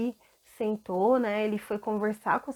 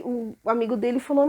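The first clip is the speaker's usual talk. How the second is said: ordinary talk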